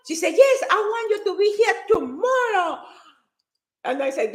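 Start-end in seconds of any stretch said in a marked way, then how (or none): 0.4-2.8 high, insistent acted command; emphatic insistence. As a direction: imitate a promoter who won't take no. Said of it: "And she was so emphatic".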